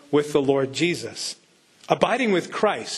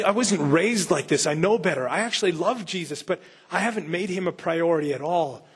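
ordinary talk, nothing marked

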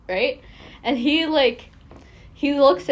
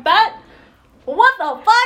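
shocked, much higher and louder; incredulous what the fuck; 9 semitones higher, 8 dB louder than usual. Retold or imitated. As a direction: blurt it out in disbelief. Shocked reaction to teacher's toxic claim.

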